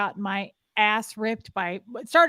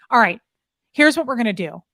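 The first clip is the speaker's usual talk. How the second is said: louder, clipped-down orders to an underling; harsh drill command; 2 semitones higher, 7 dB louder than usual. Retold or imitated. Mother scolding the young party worker, loud commanding tone. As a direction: lay down the law to the girl. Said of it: "watched her take this girl apart".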